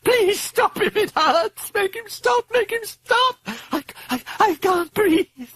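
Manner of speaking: high, panicked sobbing plea; weeping. A narrator's reading. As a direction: beg through tears, breath running out. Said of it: "she wept".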